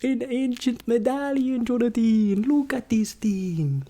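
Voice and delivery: loud, high delighted gush; excited. Acted. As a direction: show it off thrilled. Excited showing medallion.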